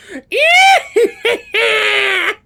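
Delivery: high, loud witch cackle; evil crone laugh. Shown as a demonstration. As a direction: shriek a wicked hag cackle. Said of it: "let me actually try and do an evil crone laugh".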